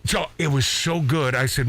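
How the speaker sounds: ordinary delivery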